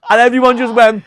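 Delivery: cracking up mid-sentence; laughing hard while telling it. Real, not acted. Telling the earring story, laughing hard through the punchline. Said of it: "No way".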